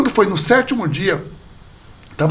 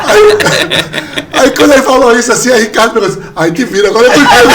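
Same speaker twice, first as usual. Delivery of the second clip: loud, high-pitched talk through laughter; real laughing; 4 semitones higher, 9 dB louder than usual. Real, not acted. Storyteller retells his brother's comeback, laughing loudly. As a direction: tell the punchline while cracking up. Laughing hard while telling punchline.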